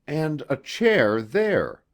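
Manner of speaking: ordinary delivery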